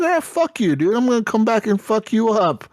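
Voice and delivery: high-pitched yelled threat; mock furious threat. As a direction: snap back at the attackers in fake rage. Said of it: "I'm like".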